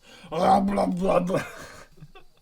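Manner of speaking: loud, high garbled munching noise; mock sandwich gobbling. Player acting out shoving sandwiches in, loud gobbling noise. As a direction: gobble noisily as if cramming in food. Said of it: "shove sandwiches in your mouth".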